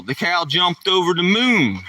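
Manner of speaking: usual speaking voice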